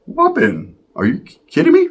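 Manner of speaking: high, incredulous; can't believe it, pitch up; outraged disbelief. Retold or imitated. Ricky reacting to Sharky's punch, high and outraged.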